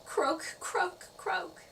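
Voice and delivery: high-pitched cartoon frog croak. A narrator's reading. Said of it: "She sounded like a frog".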